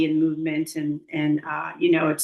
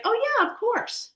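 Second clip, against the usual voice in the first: high chirpy. Retold or imitated. Retold eager answer to body.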